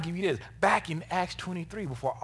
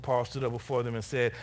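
ordinary talk

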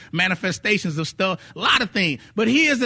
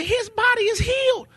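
excited wife; 13 semitones higher than usual. Retold or imitated. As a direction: shout it like the miracle just happened. Wife announces healing.

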